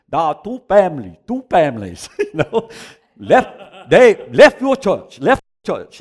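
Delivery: loud, high, halting broken English; imitating the Singapore preacher. Preacher imitating the Singapore man's prophecy, loud and halting. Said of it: "That's how he says".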